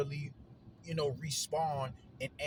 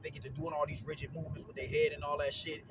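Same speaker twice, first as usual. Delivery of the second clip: ordinary talk, nothing marked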